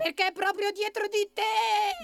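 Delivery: very high rabbit shriek; frightened cry. A narrator's reading. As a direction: cry out a warning in alarm. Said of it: "gridò Pepe". (grido acutissimo di allarme)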